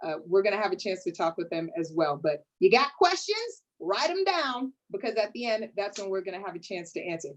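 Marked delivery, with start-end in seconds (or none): none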